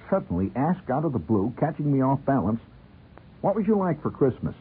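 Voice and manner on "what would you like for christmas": high-pitched voiced mother; put-on mom voice, pitched well up; mother's question. A narrator's reading. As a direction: ask it in a high motherly lilt.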